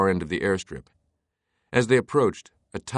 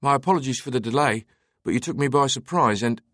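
put-on higher, quick British accent; 6 semitones higher than usual. A narrator's reading. Pilot apologising for the delay, quick British accent. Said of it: "he called in a British accent".

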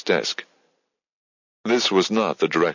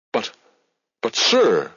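a flustered, louder halting start; stammering; 5 semitones higher, 3 dB louder, slower than usual. A narrator's reading. Midge stammers, caught off guard by her boss. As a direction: trip over the first words. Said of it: "she stammered".

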